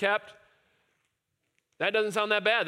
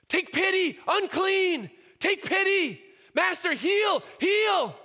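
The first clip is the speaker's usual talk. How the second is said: much higher, louder pleading shout; desperate screaming; 11 semitones higher, 4 dB louder, slower than usual. Retold or imitated. Ten lepers screaming for Jesus to heal them, desperate shout. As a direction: scream for pity and healing.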